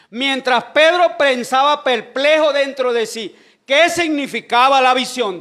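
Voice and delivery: loud preacher's delivery with drawn-out key words; preaching. Real, not acted. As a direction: proclaim the Bible story to the congregation. Preaches the story loudly.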